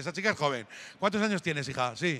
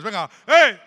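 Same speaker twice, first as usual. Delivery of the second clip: loud high yell to get the crowd going; rally the room with a shout; hooligan shout; 3 semitones higher, 11 dB louder, slower than usual. Shown as a demonstration. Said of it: "vamos a intentarlo una vez más".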